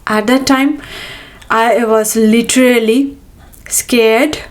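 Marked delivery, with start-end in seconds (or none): none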